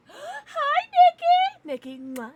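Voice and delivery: a gasp, then a very high squealed "Hi"; excited hi. Real, not acted. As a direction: squeal hello at a favourite viewer. Excited greeting to viewer Nikki.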